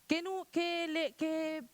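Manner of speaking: put-on high, slow, puzzled child's question; confused child. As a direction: act out a baffled child. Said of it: "el profesor dice".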